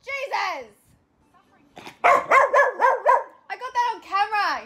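loud high exclamation; startled loud outburst. Real, not acted. Startled by the dog on camera, loud high exclamation. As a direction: burst out in shock.

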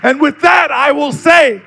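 loud, ringing oratorical climax; full-force preaching. Real, not acted. Speaker rallying church to stand in the storm, preaching full force. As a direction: drive each phrase home at a shout, rallying the congregation.

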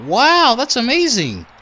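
high, gleeful cheer; excited. Real, not acted. Wins the match.

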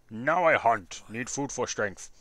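declaring like a primitive caveman. Pretend. Caveman Harold sets out to hunt for food, caveman voice. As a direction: put on a caveman voice, loud at first.

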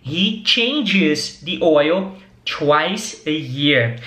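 very slow, stretched words; slow model sentence. Shown as a demonstration. Teacher stresses the verb ending for students, very slowly. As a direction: drag it out so every word is heard. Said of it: "Então é o mecânico".

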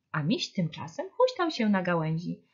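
ordinary delivery

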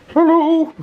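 high squeaky dog hello; dog's voice. Pretend. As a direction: greet as the dog would. Voicing the dog's greeting.

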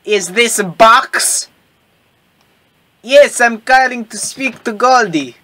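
slow, lightly voiced French accent. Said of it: "He is French".

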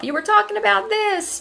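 squeaky high put-on voice of an impatient viewer; mock viewer voice. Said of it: "you guys are probably saying to yourself".

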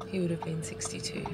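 a soft, hushed whisper of grief; barely voiced, thinking of her late dad; quiet sad whisper. Real, not acted. Dad's birthday, he died young.

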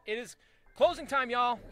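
high loud announcer call; calling out closing. Pretend. Shopkeeper announcing closing time to customers, loud call. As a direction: belt it out to the whole store.

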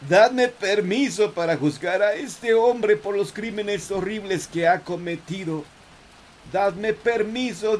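high, slow anguished prayer cry; fervent plea to God. Acted. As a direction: cry out to heaven for justice. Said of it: "Así clamó". (clamor suplicante a Dios)

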